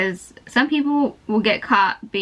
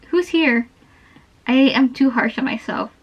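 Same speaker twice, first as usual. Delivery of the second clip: ordinary delivery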